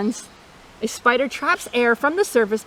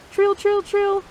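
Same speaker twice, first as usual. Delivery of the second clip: toad trill; 9 semitones higher than usual. Retold or imitated. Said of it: "American toad".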